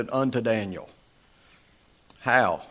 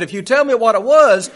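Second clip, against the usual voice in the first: demanding king; 6 semitones higher, 7 dB louder than usual. Retold or imitated. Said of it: "He said".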